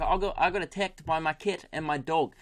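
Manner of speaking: imitated Kiwi radio caller; New Zealand accent. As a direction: exaggerate the New Zealand vowels. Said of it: "I've got it now".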